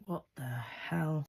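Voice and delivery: slow, low, quiet disbelief; baffled mutter. Retold or imitated. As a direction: mutter it slowly, imitating a stunned boyfriend. Acting Steve's baffled muttered reaction.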